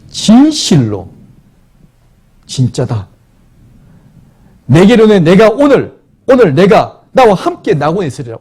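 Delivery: loud, slow and lifted promise of paradise; solemn proclamation. Acted. Preacher voices Jesus' promise. (크고 느린 엄숙한 선포)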